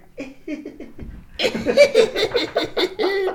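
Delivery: high, long imitation cackle; mocking creepy giggle; witchy cackle. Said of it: "Like a witch".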